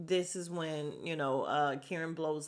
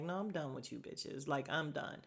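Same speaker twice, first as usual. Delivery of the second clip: softer, lower, done with them all; fed-up mutter; 4 semitones lower, 8 dB quieter than usual. Retold or imitated. Vlogger picturing herself quitting the girls, soft fed-up mutter. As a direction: mutter it like you're walking out.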